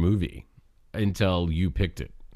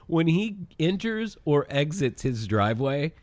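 laughing; 7 semitones higher than usual. Real, not acted. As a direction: laugh through the line. Laughing about the driving.

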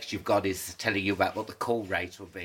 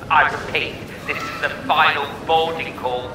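airport announcement; 4 semitones higher, 4 dB louder than usual. Acted. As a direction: call the passenger over the airport tannoy. Mock final boarding call.

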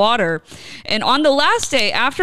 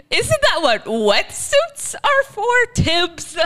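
mocking; 10 semitones higher, slower than usual. Real, not acted. Mocking the diver's excuse.

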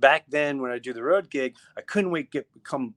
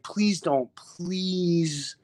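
retold pleading; 5 semitones higher, much slower than usual. Retold pleading with his friend.

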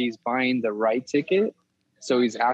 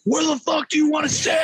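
mock furious yell; 13 semitones higher than usual. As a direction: scream it like an absurdly rude cashier. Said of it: "imagine going to the movie theater".

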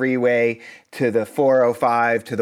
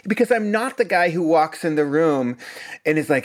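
ordinary delivery

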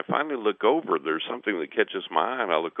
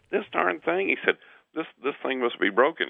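higher-pitched mock frustration; retold exasperation; 7 semitones higher than usual. Gas man blames his meter, retold exasperation. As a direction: grumble at a misbehaving gadget, pitched up.

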